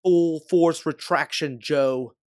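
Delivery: loud, slow, high command shout; full-force yelled command. A narrator's reading. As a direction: bellow the order to the suit. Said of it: "you give the command".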